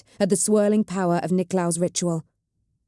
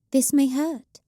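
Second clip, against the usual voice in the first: put-on higher, detached young witch; 8 semitones higher, slower than usual. A narrator's reading. The witch crouching by Cheta, higher detached voice. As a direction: speak cool and distant.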